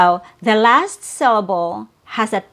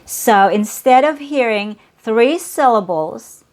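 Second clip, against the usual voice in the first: ordinary talk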